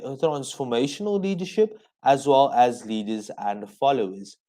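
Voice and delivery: usual speaking voice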